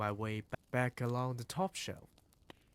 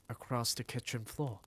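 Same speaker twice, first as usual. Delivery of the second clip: ordinary delivery, nothing marked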